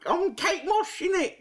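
very high, guessed-at model voice; badly faked high-pitched model; Kate Moss. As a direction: squeak it out with a rough London twang. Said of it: "what Kate Moss sounds like".

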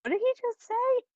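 very high incredulous question. Retold or imitated. Host recalling Travolta's slip, incredulous. Acting out own baffled reaction.

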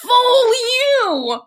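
high, drawn-out yell; all-caps outburst. Acted. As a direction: read it as if typed in all capitals. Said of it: "this is in all caps".

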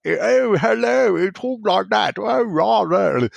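high-pitched mock-posh toff; posh accent. Host imitating a lawyer West Ham fan, exaggerated posh voice. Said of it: "He is the poshest sounding West Ham fan".

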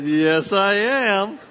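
high, loud, through a laugh; laughing reply. Real, not acted. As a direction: own up to it with a laugh. Admitting being recognised, laughing.

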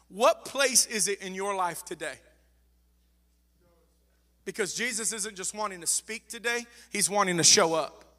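ordinary talk

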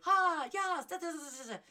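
high, loud burst of hyper cheer; animated and up. Shown as a demonstration. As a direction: act out being hugely upbeat, babbling away. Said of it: "very animated and very up".

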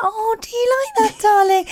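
put-on high sing-song talk to a toddler; mother cooing to her little girl at mealtime; cooing mum voice. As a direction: coo at the baby over her food. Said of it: "video of Clemmy... of her eating".

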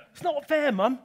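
put-on a kid protesting to his mum; whining child. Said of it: "what my mum used to say to me".

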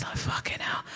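unvoiced whispered curse; hushed swear. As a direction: breathe it out under your breath. Whispered exclamation in story.

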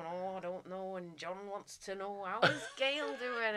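slow mock Liverpool accent; bad Liverpool accent. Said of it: "trying to do a Liverpool accent".

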